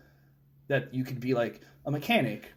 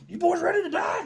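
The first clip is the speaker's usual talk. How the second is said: much higher hammed-up sergeant bark; mock drill sergeant; 4 dB louder than usual. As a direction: play a cartoonish sergeant yelling at recruits. Imagined drill sergeant greeting recruits.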